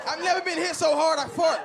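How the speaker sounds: ordinary talk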